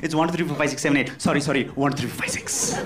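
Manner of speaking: rattled off fast; fast password recital. Shown as a demonstration. Host rattling off the password to the guest, fast. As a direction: race through the numbers.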